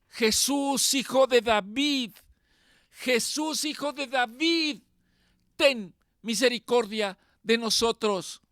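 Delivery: mild mock cry for mercy, pitched high; blind men's cry. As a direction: call out like a beggar by the road, half-acted. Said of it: "empezaron a gritar".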